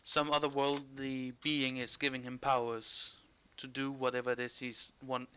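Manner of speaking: ordinary talk, nothing marked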